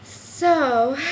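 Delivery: breathless, gasping between words; out of breath. Real, not acted. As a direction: talk while winded. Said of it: "I'm so out of breath".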